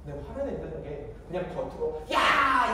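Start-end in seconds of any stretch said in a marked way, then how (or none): 2.1-2.7 sharp, high shout; loud angry yell. Shown as a demonstration. Preacher demonstrates outward anger, sudden loud shout. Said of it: "이렇게 큰 소리 내는 게".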